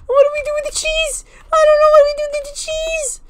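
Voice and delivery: helpless, very high wail; high-pitched panic. Real, not acted. Player clueless about the cheese, high-pitched panic. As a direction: squeal it, completely lost.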